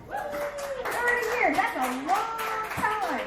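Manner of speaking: "Thirty years" stretched slow and high; drawn-out emphasis. Real, not acted. Comic telling crowd of long marriage, "Thirty years" slowly drawn out.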